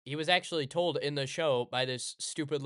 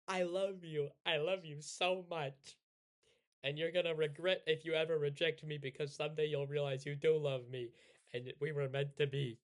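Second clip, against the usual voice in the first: softer pleading confession; 4 dB quieter than usual. Retold or imitated. Hina softly confessing her love to Taiki, desperate. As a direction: plead with him softly, half crying. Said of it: "she's like".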